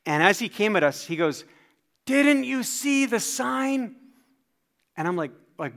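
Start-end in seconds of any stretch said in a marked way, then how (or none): 2.0-4.0 high, drawn-out indignant holler; angry construction worker. Retold or imitated. Construction man confronting a family on the sidewalk, angry. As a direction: holler it slowly at trespassers.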